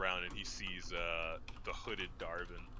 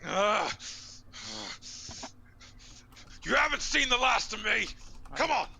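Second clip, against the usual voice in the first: sputtering foiled villain; 10 dB louder than usual. Acted. Barbarian knocked down, retreating.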